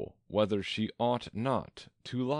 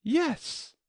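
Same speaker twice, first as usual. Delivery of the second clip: very high shouted answer; high-pitched girl's shout. A narrator's reading. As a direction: shout back eagerly as Alice. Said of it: "shouted Alice".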